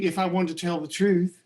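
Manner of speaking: normal talking voice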